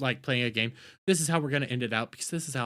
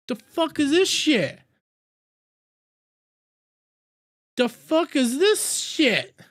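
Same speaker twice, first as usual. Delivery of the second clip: higher, louder shocked yelling; shocked outburst; 11 semitones higher, 5 dB louder than usual. Real, not acted. Streamer yelling in disbelief at the clip. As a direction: react in loud disbelief.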